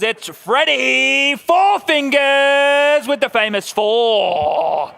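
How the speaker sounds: loud, high, slow drawn-out intro; boxing-style intro; ring announcer. Acted. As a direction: introduce the fighter to the crowd.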